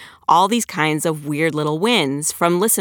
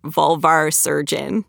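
vulvar surgeon said slowly and deliberately; measured out word by word to get it right; slow, careful; slower than usual. Real, not acted. Author showing how she gets hard words out, slow and careful. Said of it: "I have to say them slow like that".

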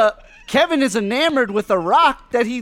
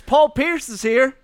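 usual speaking voice

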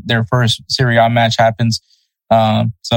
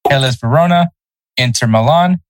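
ordinary talk

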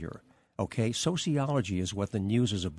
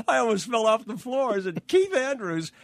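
high-pitched, incredulous retelling; tickled, high-pitched astonishment; amused disbelief; 12 semitones higher, 4 dB louder than usual. Retold or imitated. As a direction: relive the absurd shock, voice shooting up. Amused disbelief at suggested stage name.

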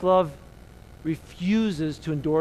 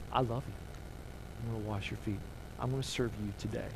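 low, hushed and tender; tender Jesus; 5 semitones lower, 11 dB quieter than usual. Acted. Jesus to his disciples before washing their feet, tender. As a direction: softly voice a loving promise to serve.

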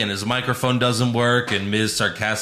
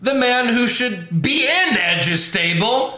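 hyped reveal; 11 semitones higher, slower than usual. Acted. Hyping Finn Balor's entrance.

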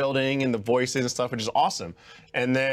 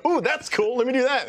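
high eager ooh; thrilled at the idea; excited; 10 semitones higher than usual. Retold or imitated. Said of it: "I go".

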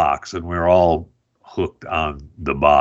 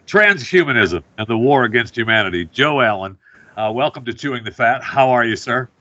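ordinary delivery

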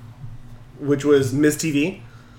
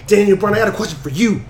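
higher-pitched, imitated serious address; mock serious challenge; 5 semitones higher, 3 dB louder, faster than usual. Wrestler addressing his rival on a talk show, mock serious. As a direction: pose it like a pompous challenge. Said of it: "All serious and whatnot".

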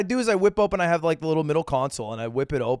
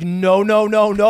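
louder, higher urgent protest; 4 semitones higher, 8 dB louder, slower than usual. Retold or imitated. Nick stops the driver going back, loud urgent protest. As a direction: stop him from pulling over. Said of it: "and Nick's like".

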